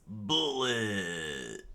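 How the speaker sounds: shouted attack name. Acted. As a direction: call out the punch. Luffy's attack call.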